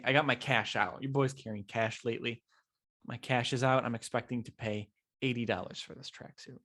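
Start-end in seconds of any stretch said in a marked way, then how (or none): none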